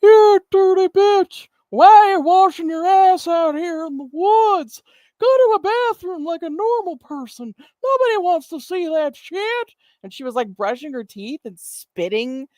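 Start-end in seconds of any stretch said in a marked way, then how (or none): 0.0-9.7 shrill outraged geezer ranting; cranky old man. Retold or imitated. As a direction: play a disgusted old man yelling at her. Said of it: "was basically like".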